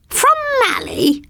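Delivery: high, slow screeching queen; queen horrified at Mally's magic, screeching high. Acted. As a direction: shriek in disbelief. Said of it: "screeched Glad".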